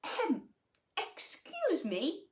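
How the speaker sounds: cricket clearing throat. Acted. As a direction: cough politely as a tiny cricket. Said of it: "coughed a cricket".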